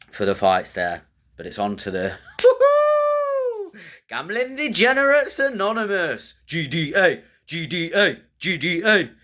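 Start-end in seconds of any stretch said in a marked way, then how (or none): 2.4-3.7 squealing laugh, much higher; a gleeful high-pitched cackle; high giddy laugh. Acted. Host kicking off the betting segment with a high squealing laugh. 4.1-9.1 much higher, chanted announcer voice; hype announcer. Acted. Host introducing his betting segment with a high hype-announcer chant.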